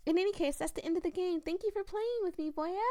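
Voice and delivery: put-on high, timid clown girl voice; Lala's high voice. Streamer voicing Lala to the player, high timid voice.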